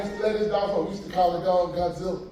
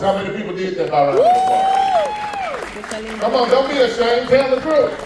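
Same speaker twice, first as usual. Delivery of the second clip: crowd call-out; 5 semitones higher, 5 dB louder than usual. Real, not acted. Calling on the audience.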